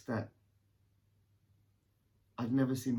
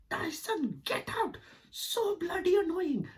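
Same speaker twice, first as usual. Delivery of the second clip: put-on Indian accent; slower than usual. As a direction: shriek it like an Indian mum. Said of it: "my mum's got a bit of an Indian accent".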